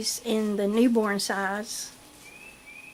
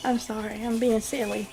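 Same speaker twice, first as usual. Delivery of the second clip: ordinary talk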